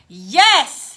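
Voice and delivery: loud, exasperated reply; loud and fed up; tiny bit infuriated. Acted. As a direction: answer with mounting impatience. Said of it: "becoming a tiny bit infuriated now".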